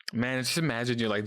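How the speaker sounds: ordinary talk, nothing marked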